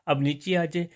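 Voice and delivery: ordinary talk, nothing marked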